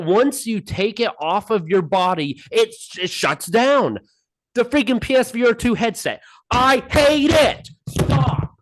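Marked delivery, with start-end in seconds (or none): 6.5-8.6 higher, slow, exasperated burst; getting angry. Real, not acted. Host ranting to his co-host about the headset, angry. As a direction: vent real frustration at the headset. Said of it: "I'm getting angry".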